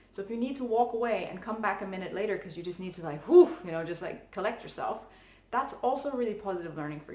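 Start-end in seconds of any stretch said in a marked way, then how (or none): 3.3-3.6 loud, sharp "whew" of relief; big exhale. Pretend. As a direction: blow out tension with a whew. Acting out collecting yourself.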